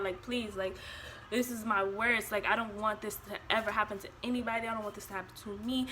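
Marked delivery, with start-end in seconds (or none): none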